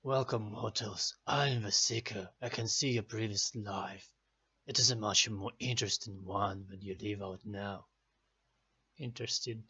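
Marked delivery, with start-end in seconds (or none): 0.0-7.9 deep ominous fortune teller; horror host. Acted. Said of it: "fun Celtic stuff".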